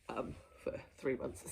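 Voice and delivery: soft guilty whisper; sheepish whisper. Real, not acted. Vlogger admits to viewers, guiltily whispering. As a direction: confess it under your breath. Embarrassed confession aside.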